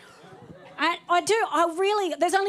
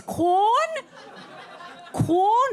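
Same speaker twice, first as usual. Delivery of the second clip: Quorn? with a high rising question lilt; apologetic question; 11 semitones higher than usual. Shown as a demonstration. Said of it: "It's so apologetic".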